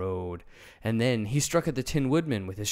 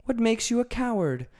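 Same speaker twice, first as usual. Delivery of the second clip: much higher, louder curious question; Dorothy asking the lion in wonder; 14 semitones higher, 5 dB louder than usual. A narrator's reading. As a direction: ask in wonder. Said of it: "looking at the great beast in wonder".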